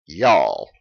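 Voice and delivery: "Y'all" stretched long and low in pitch, falling at the end; Southern "Y'all", long and falling; drawn-out y'all. Shown as a demonstration. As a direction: draw out "Y'all" slow and low in a Southern drawl. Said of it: "You gotta kinda draw it out there".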